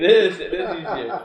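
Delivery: amused, with a laugh. Real, not acted. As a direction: say it laughing lightly.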